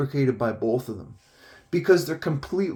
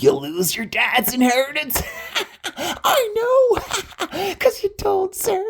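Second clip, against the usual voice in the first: very high cackling voice; mock gloating laughter; slower than usual. Don taunting Dave about the inheritance, high gleeful cackle. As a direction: imitate someone cackling through the words.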